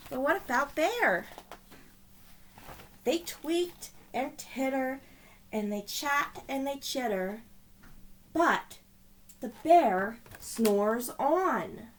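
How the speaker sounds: put-on lively read-aloud voice for children; storybook narration. A narrator's reading. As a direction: tell it like a bedtime picture book. Reading picture book aloud.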